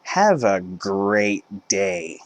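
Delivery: "Have a great day" drawn out very slow and sweet; retail voice. Retold or imitated. Cashier seeing off the customer, very slow polite "Have a great day". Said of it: "in my retail voice said".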